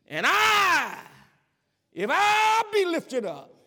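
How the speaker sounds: rising, slow, shouting preacher's climax; preaching at full force. Real, not acted. Preacher crying out Jesus' words to the congregation, full-throated shout. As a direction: build the sermon to a roaring peak.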